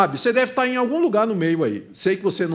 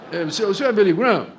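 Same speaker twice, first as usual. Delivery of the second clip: put-on slurred, fast drunk mumble, laughing; halting drunk voice, stumbling over words; drunk with hiccups; much faster than usual. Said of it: "Desculpa, eu não sei imitar bêbado".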